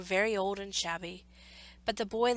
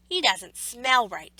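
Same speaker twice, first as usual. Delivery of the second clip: drawn-out, louder exclaim; a slow, louder exclaim of suspicion; slow suspicious exclaim; 3 semitones higher, 8 dB louder, slower than usual. A narrator's reading. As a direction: sniff him out, disgusted. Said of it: "he exclaimed".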